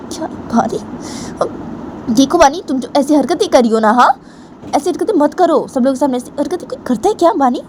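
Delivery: put-on wag a finger at the girl; grandmother scolding.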